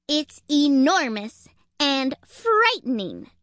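frightened squirrel insisting, high and emphatic; panicked squirrel. A narrator's reading. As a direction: stretch out each word in alarm. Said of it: "out of breath and in a panic".

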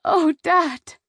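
high, overwrought plea; tearful cry. A narrator's reading. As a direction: cry out to a father, close to tears. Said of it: "bursting into tears".

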